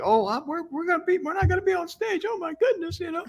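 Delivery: very high, flustered mock voice; high-pitched mimicry. Co-host teasing his partner about going onstage, high mimicking voice. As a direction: imitate a co-host in a squeaky-high flutter. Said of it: "I didn't think my voice went that high".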